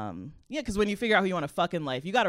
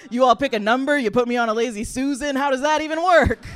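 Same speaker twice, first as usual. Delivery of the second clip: mocking joke; 7 semitones higher, 7 dB louder than usual. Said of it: "I'm just like, making jokes".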